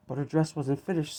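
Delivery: plain reading voice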